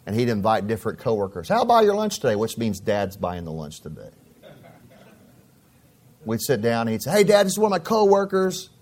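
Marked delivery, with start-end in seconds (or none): 1.5-2.3 son's voice. Retold or imitated. Son inviting dad, high voiced. 7.1-8.7 put-on high, bright young son voice; son's cheerful voice. Son introducing his coworker to dad, high and eager.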